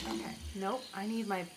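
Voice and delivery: ordinary talk, nothing marked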